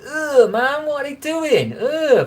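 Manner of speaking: high mock-disgusted groan of revulsion; disgusted ugh. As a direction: pull a grossed-out face at the squid. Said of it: "I was like".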